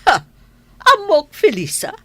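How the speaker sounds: very high sharp accusation; high accusing opening. Acted. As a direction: confront her coldly. Lady of the house accuses maid.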